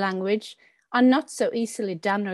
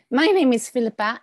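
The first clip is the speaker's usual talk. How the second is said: normal talking voice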